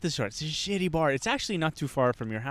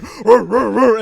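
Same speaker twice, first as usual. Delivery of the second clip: loud, higher imitated dog barks; pit bull barking; 6 semitones higher, 12 dB louder, slower than usual. Telling how the party sounded, imitated pit bull barking. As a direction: bark out a pit bull impression. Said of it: "a pit bull going".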